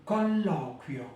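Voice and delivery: Colloquio, uio in one syllable; Italian model word. Shown as a demonstration. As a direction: say Colloquio for students to repeat. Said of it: "vous pouvez répéter".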